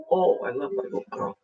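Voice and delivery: soft whispered love to the pet; whispered affection. Real, not acted. Whispering love to her pet Cassie, soft.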